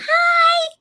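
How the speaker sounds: tiny, very high squeak; pet-calling voice. Real, not acted. Saying hello to the dog in a squeaky pet voice. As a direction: greet the dog in a squeaky falsetto. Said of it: "I gotta do that with the voice".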